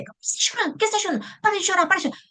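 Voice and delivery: put-on high, exasperated scolding of a crying toddler; impatient parent.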